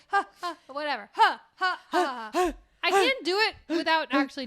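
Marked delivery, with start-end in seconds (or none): 0.1-2.5 loud breathy punch grunts. Retold or imitated. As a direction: huff with every punch. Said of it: "with every punch".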